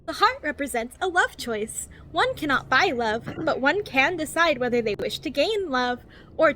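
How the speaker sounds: over-enunciated guidebook narration, lilting up and down; cheery infomercial voice. Acted. Reading a survival guide to new players, cheery infomercial voice. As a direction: read it like a relentlessly positive infomercial. Reads the game's guide book.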